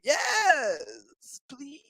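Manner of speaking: drawn-out high yes, tiny please; hammed-up eager yes fading to a squeak; mock pleading. Picard's eager reply, retold.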